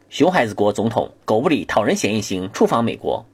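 usual speaking voice

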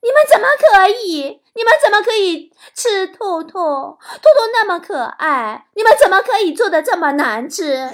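high, loud outraged complaint, then dragged out; mock outrage over bad cooking; indignant punchline. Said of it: "如果是我的话，我肯定会说".

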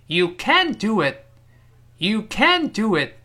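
can't punched high and loud with a clear front "a"; slow sentence, heavy weight on can't; stressed can't. Shown as a demonstration. Teacher modelling the American negative, can't stressed high. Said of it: "这个时候发生清晰明亮的一个哎这个声音".

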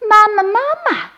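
put-on very high, excited child's cry; happy calling. A narrator's reading. Baby turtles crowd round their mother, calling happily. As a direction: call joyfully in a tiny hatchling voice. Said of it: "高兴地叫着".

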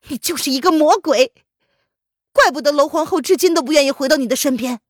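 high, loud furious accusation; angry accusation. Acted. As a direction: hurl the blame at him. Accusing the emperor heatedly. (愤怒控诉)

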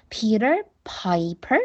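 Peter Piper said slowly, each syllable clear; slow model reading. Shown as a demonstration. Teacher modelling the phrase for pupils, slowly. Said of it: "P E P就可以".

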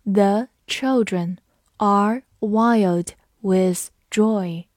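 slow, every word spaced out; slow model sentence; slow read. Shown as a demonstration. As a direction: say it slowly for students to repeat. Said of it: "跟着我慢读一遍".

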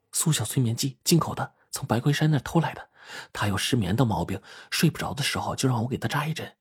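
soft, secretive explanation; murmured aside while in hiding; hushed reply. A narrator's reading. Answering a hushed question in hiding.

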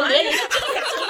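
high laughing outburst. Real, not acted. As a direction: laugh through the words. Laughing at wrong airline name.

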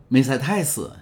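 loud, high call from outside; cheerful shout across the yard; joyful call. A narrator's reading. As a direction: call her name happily from outside. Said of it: "兴冲冲地叫了起来".

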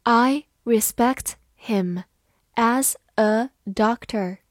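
slow, careful read-aloud; slow classroom model reading. Shown as a demonstration. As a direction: read it slowly for the class to follow. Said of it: "好，慢慢来读。".

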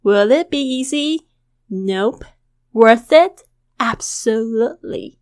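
teacher reads the dialogue for students to copy; model read. Shown as a demonstration. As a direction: clear lively dialogue read. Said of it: "我们来就是再念一次".

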